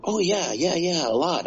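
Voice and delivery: overeager. Retold or imitated. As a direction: sell yourself a bit too hard. Overeager answer about acting.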